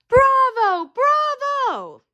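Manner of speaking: high, ringing cheers of bravo; cheering. Acted. Students applaud the officer after his speech, cheering excitedly. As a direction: cheer wildly like a thrilled crowd. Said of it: "they cheered".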